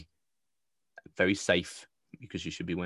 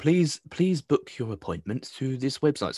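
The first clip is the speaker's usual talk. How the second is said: slow, higher and prim official announcement; official text voice; 4 semitones higher, slower than usual. Retold or imitated. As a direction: recite it like a fussy automated notice. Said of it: "Keep the voices coming".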